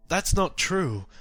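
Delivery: high, loud protest; shocked denial. Acted. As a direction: protest in disbelief.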